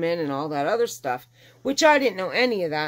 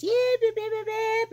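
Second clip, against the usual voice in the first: very high-pitched imitated puppy yips; puppy yipping. Owner mimics what she heard at the vet, high yipping. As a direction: yip like a little puppy in the back. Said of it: "I hear this yipping".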